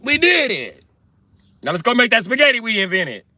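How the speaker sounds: high, hammed-up Italian-American boasting; Italian accent. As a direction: crow it in a mock Italian accent. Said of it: "The Italian accent".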